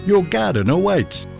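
usual speaking voice

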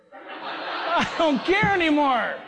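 high, slow, laughing through it; laughing despair. Real, not acted. Preacher laughs about longing for heaven, slow and high. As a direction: laugh at your own desperation. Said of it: "I'm getting so desperate".

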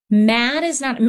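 ordinary talk, nothing marked

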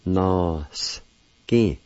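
"Nos. Ki." said as two separate syllables, nohs then kee; Cherokee syllables. Shown as a demonstration. Teacher giving the first part, syllable by syllable. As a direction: say "Nos" then "Ki", set apart. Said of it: "First part is pronounced like this".

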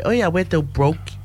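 ordinary talk, nothing marked

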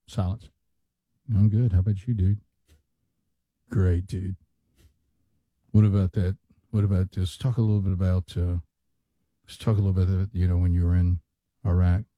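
lazy podcast host; mocking podcast bros. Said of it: "this is how they talk".

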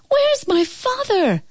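put-on high, alarmed young voice; frightened boy. A narrator's reading. Boy who just saw his father turn dragon, frightened. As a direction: cry out in panic for his father.

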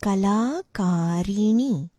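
"Kalakarini" slowly, long "la" and "ka", stressed "ni"; model pronunciation. Shown as a demonstration. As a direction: draw out "Kalakarini" for the learner. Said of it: "quite a bit of stress".

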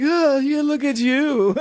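high, patronizing look at you; condescending air. Retold or imitated. Imitating Delvey's condescension, high and patronizing. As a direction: pat them on the head with your voice, high. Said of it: "this air of like".

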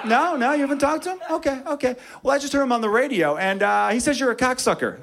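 high, mock-innocent needling voice; teasing coworker. Warehouse friend needles [name]'s dad at lunch, teasing. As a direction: pitch it high, feigning a casual question. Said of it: "All of his friends give him a hard time".

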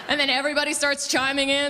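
ordinary talk

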